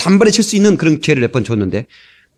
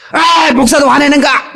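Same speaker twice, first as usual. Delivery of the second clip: sarcastic "pastors get angry too?"; mocking jab; 10 semitones higher, slower than usual. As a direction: drag out the gotcha question. Congregant mocks angry pastor.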